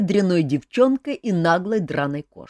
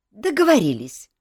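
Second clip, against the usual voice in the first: firm, resolute agreement; decisive; 4 semitones higher, 5 dB louder than usual. A narrator's reading. Ethel agreeing to the king's terms, decisively. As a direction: clinch the deal firmly. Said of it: "решительно кивнула Этель".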